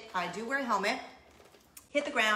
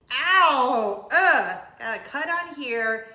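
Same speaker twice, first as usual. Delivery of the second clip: mock pained ow, louder and drawn out; retold ouch; 2 semitones higher, 10 dB louder, much slower than usual. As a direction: relive the sting of the cut. Retelling pain after crash.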